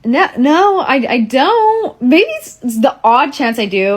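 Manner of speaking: high, hesitant, flustered mock voice; awkward mock reply. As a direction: squeak out an awkward denial. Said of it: "And I'm like".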